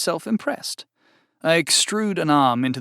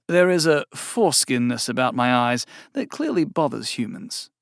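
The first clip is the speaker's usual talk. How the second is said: usual speaking voice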